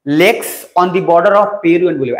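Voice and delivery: drawn-out, deliberate note-giving; slow dictation. Real, not acted. Teacher dictating a lake heading to students, slow dictation. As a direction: dictate it slowly, word by word.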